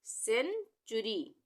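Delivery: सेंचुरी drawn out slowly, starting with s; soft c sound. Shown as a demonstration. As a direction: break सेंचुरी slowly into sen and churi. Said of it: "सीई से क्या pronounce होगा".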